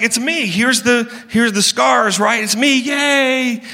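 lightly hammed-up excited Jesus. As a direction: play Jesus bubbling over with excitement. Acted excited risen Jesus.

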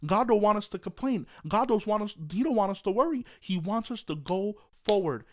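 loud, high preaching voice; loud revival-style exhortation; fiery preaching. Acted. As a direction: preach it with full force. Preaching at full force.

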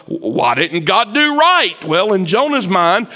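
normal talking voice

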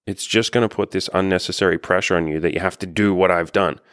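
ordinary talk, nothing marked

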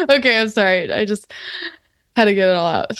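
normal talking voice

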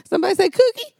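high, loud parrot squawk. Pretend. Explaining that hawks talk like parrots, high squawk. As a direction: squawk it like a parrot repeating words. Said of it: "Isn't that what a parrot sounds like".

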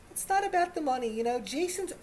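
mocking the show's women, high put-on voice; mocking woman's voice. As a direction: parody a woman insisting it's not about money. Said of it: "women still will tell us".

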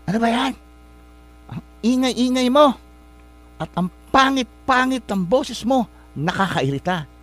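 high, sharp, exasperated mother's snap; irritated scolding. Retold or imitated. Tired mother snapping at her singing daughter, annoyed. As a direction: snap at the child like a worn-out mom.

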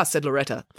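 plain reading voice

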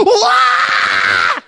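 sudden high shouted yelp of fright; a hammed-up scream of surprise on turning around; startled yelp. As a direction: jump out of your skin with a loud yelp. Startled at the man with bat.

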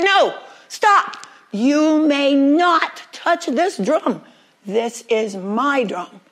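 sharp, loud cry that slows into a firm warning; alarmed shout. Acted. Cat to Rat reaching for his precious drum, loud slow warning. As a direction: stop the grab, then lay down the law slowly.

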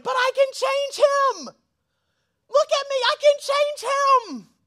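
high, loud, slow mock squeal of a girl in love; mocking a smitten woman. Preacher mocking a lovestruck friend, high slow mock shout. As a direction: ham up the smitten girlfriend voice. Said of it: "Ladies, you already know".

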